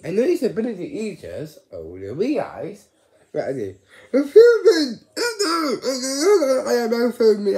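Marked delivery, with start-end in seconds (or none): 4.1-7.6 loud, high, laughing yell; gleeful cry; excited laughing shout. Real, not acted.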